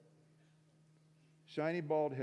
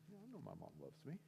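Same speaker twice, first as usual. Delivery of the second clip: very soft, quick mumble; grudging; 17 dB quieter, much faster than usual. Retold or imitated. Son answering pastor about his mom, grudgingly quiet. Muttered reluctant reply to pastor.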